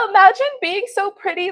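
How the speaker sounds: high, loud, laughing brag; laughing delight. Real, not acted. Viewer insulted only her earphones, gleeful bragging. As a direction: crow it straight out of a laugh.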